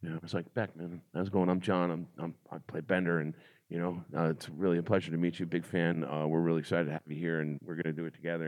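quiet earnest intro to a star; straight-faced professional. Retold or imitated. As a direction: rattle off a hushed formal greeting. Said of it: "he's all professional and just straight face".

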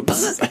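high buzzing zap noise; high buzzing sound of a mic shock; imitated electric buzz. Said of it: "you go".

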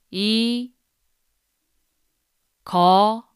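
"이 거 매 워 요" one syllable at a time, with long pauses; sounding out words. Shown as a demonstration. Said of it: "break it into syllables so that you can repeat after me".

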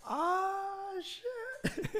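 high squeaky mock gasp; a startled high yelp of a curse; surprised. Telling of spotting the neighbor, squeaky startled curse. Surprised reaction in retold moment.

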